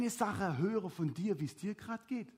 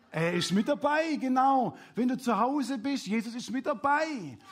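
raised, emphatic, encouraging answer; upbeat, louder confirmation; rousing affirmation; 6 semitones higher, 5 dB louder than usual. Real, not acted.

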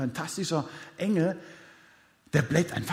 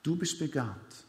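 tender God voice; 5 semitones lower, 9 dB quieter than usual. Pretend. God reassuring the doubting listener, soft and tender. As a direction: gently speak as God who wants you.

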